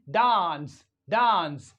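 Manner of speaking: DANCE as 'dahns', long open vowel; British long A. Shown as a demonstration. Teacher modelling British English, long-ah DANCE. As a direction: say dance with the A of father. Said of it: "The same way we pronounce".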